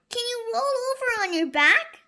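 put-on high, fast, loud kid voice; eager children. Children asking the dinosaur for a trick, high and fast. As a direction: ask it like a bouncing child.

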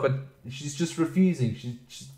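usual speaking voice

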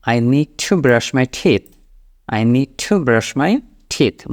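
very slow, each word clear; slow model sentence. Shown as a demonstration. Teacher showing learners what to say at the sink, very slow. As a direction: lay it out slowly to be repeated. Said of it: "ওই জায়গাটাও আপনি হচ্ছে বলবেন".

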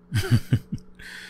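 a quick breathy chuckle. Acted. After calling the roommate a workaholic, breathy chuckle. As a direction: chuckle at your own tease.

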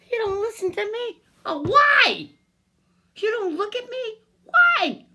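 high-pitched pleading that bursts into a shout; distraught demand. Real, not acted. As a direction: demand to know why nobody will listen. Pleading to be heard, upset.

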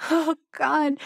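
high, hysterical laughing "Oh, God"; mock breakdown laugh. Imitating someone with a psychological break, high hysterical laugh.

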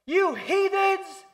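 high, loud hammed-up tantrum, slow at first; over-the-top mock outrage shout; mock ranting Daniel. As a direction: play a whiny protester yelling at captors. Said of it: "Daniel did not go".

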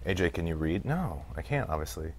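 usual speaking voice

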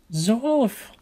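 put-on high, relieved cry of an old gnome; old gnome's cry. A narrator's reading. Grandmother gnome finding her lost grandson, high relieved cry. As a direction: call out the lost child's name in relief.

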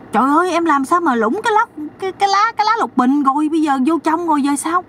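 mock panic at the brother-in-law; panicked high woman. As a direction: squeal in hammed-up alarm. Sister-in-law panics over the leaf.

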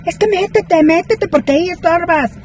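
high, insistent mock yell; grito imitado. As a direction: mimic a woman yelling at you, high-pitched. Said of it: "me empieza a gritar así". (imitación aguda de gritos)

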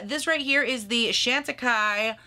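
ordinary delivery

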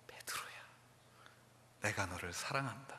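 soft, low loving words; Jesus comforting Peter, tender and soft. Retold or imitated. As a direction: say it gently, with love.

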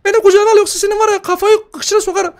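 a tiny brat swearing; squeaky baby voice. Pretend. Gamer voices the demon baby cursing him, squeaky.

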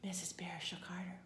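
ordinary delivery

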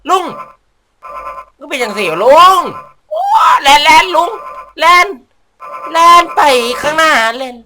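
loud high urgent cheering; frantic yelling. Real, not acted. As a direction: yell him forward in a panic. Urging character on, yelling.

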